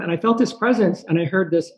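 ordinary delivery

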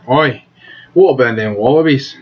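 loud, rising Australian holler; Australian accent. Shown as a demonstration. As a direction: do a hammed-up Aussie pitch. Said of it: "with an Australian accent and be like".

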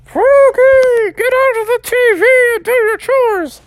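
very high loud mom scream; high shrill-free screaming mother. Acted. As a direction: scream for him to do chores. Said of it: "Mother Frog screaming out".

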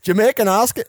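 put-on high laughing Jamaican accent try; a cracking attempt at Jamaican accent. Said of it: "I can't".

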